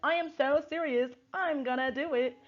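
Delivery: high put-on accent, clearly shifted; hammed-up borrowed accent, high voice; Sutton accent. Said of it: "I went into a whole different, um, accent".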